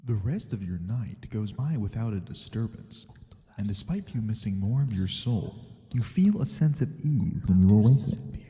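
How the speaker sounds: hushed, gentle narrator closing the scene; soft story narration. A narrator's reading. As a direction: let the story settle softly into sleep. Narrator closes the dream scene.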